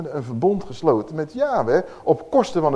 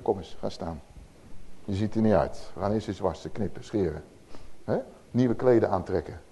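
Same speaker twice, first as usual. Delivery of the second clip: softer, lower, gently pitying; tender fatherly voice; 8 semitones lower, 9 dB quieter than usual. Acted. God comforts the fallen child, soft and low. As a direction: speak softly to a lost child.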